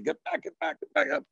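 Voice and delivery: high, alarmed shooing; people shouting get back. Retold or imitated. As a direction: shoo people away, high and tense.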